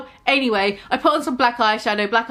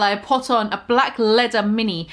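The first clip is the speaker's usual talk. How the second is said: ordinary delivery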